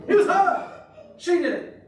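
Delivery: high, mock accusing outburst; blame-shifting. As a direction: pass the blame like a tattling kid. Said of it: "Notice the blame game straight away".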